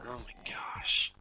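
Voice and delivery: slow exasperated whisper. Real, not acted. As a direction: breathe it out in dismay. Exasperation after failing.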